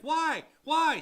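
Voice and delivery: loud, high mock demand from the seller; hammed-up seller pleading why, high and loud. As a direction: yell the seller's indignant why. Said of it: "The seller's like".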